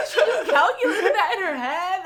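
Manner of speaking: high, loud, laughing mock awe; imitated kids freaking out. Babysitter imitating kids awed by mental math, freaking out. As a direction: exclaim like an astounded child. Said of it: "they freak out".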